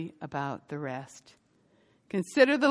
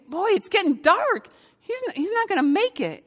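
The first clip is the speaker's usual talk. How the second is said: much higher, fretful worry; worried fretting; 12 semitones higher, 4 dB louder than usual. Retold or imitated. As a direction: fret aloud as the light fades. Woman worried at dusk.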